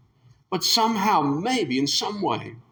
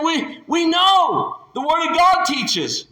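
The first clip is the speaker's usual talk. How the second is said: haughty retort; 14 semitones higher, 5 dB louder, slower than usual. Acted. Pharisees rebut, slow.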